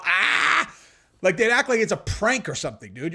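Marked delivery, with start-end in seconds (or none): 0.0-0.7 mock gasp. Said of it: "they'd be all".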